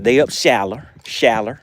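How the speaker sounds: "shallow" ending in an R, like shaller. Shown as a demonstration. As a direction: say "shallow" with a final er sound. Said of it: "say it with an R on the end".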